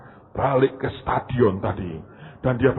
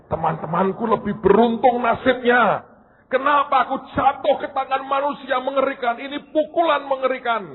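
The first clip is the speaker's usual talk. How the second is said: much higher, louder wailing lament; whining ball; 12 semitones higher, 5 dB louder than usual. Acted. Ball lamenting its fate to the listeners, high and whiny. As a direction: wail about its bad luck.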